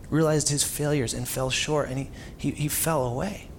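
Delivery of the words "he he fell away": usual speaking voice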